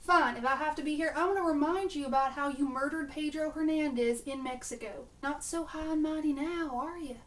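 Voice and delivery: a bad Southern accent, half-hearted; atrocious southern accent. Riley taunting Gibbs in a bad Southern accent. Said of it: "my atrocious southern accent".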